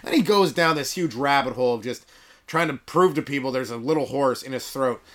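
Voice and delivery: ordinary talk, nothing marked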